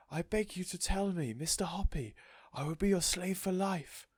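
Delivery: high, breathless plea with whispered name; begging. A narrator's reading. Missus Silver begging Mister Hoppy, desperate. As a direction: beg with all your heart.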